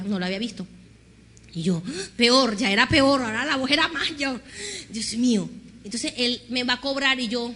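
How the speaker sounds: ordinary delivery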